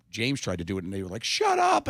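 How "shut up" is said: high, loud jeering shout; mock heckler yell; crowd heckle. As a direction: shout him down like a rowdy crowd. Crowd turning on his friend.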